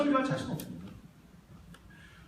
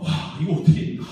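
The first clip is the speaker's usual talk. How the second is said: ordinary talk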